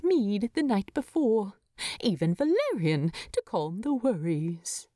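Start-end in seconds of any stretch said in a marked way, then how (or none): none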